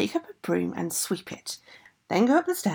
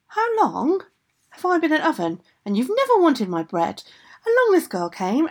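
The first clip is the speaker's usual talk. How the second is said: high-pitched, raised storybook retort; indignant complaint; 7 semitones higher, 4 dB louder than usual. Acted. Oven answers ogress back, indignant complaint. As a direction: throw the grievance back, pitched up.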